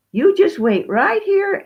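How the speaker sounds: high, loud, eager hostess; high-voiced, bustling welcome; excited old widow. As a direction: pitch it up like a delighted old lady. Widow excitedly tells guests to wait.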